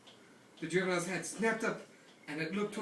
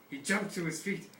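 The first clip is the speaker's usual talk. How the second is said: ordinary delivery, nothing marked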